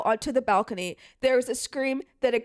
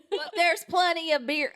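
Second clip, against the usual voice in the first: mock country accent, a bit higher; 5 semitones higher than usual. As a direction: lay on a rural country accent. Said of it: "Now we're country".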